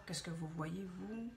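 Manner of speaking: ordinary talk, nothing marked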